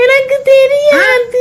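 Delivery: sobbing out the words; tearful, slow, high sob; choked up and crying. Acted. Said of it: "ஏன் அழுகுற?".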